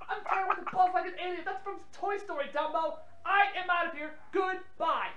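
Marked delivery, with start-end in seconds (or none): none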